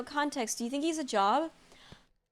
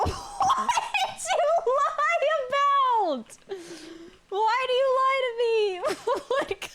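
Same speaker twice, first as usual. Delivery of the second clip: laughing outburst; 12 semitones higher than usual. Real, not acted. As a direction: squeal through the laughter. Laughing at friend's lie.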